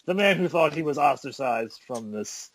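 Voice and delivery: slow, drawn-out ring announcer introduction; show announcer intro. Acted. Host introducing his returning co-host, announcer style. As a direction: announce the returning champion with big stretched words.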